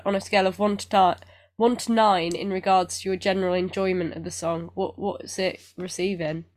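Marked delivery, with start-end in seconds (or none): none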